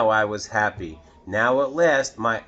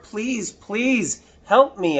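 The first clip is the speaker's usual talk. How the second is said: much higher, louder begging; desperate plea; 10 semitones higher, 4 dB louder, slower than usual. Acted. Boy begging Mister Carp on the phone, desperate. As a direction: beg for help urgently. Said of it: "I said".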